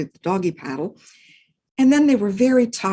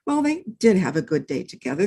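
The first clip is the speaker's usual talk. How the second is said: ordinary delivery, nothing marked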